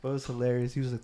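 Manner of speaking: ordinary talk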